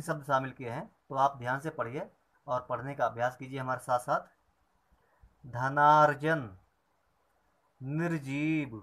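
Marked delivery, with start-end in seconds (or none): 5.5-8.8 loud clear dictation of words; slow classroom recitation; word list drill. Shown as a demonstration. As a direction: read each word out for the class to repeat. Teacher reads word list aloud.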